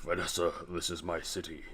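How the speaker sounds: low, restrained villain voice; Fisk impression. Crime boss talking to Vanessa, low measured impression. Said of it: "That's a pretty good impression".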